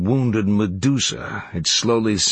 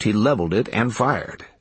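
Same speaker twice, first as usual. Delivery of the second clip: ordinary delivery, nothing marked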